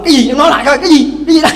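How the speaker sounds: husband daring his wife to repeat it, in mock anger; mock angry husband. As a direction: bark the question back as an angry husband. Husband demands angrily.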